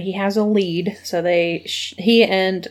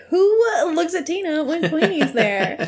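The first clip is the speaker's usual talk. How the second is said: laughing; 8 semitones higher than usual. Real, not acted. Laughing incredulous aside.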